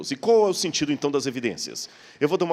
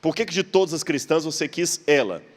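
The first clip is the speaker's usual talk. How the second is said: ordinary delivery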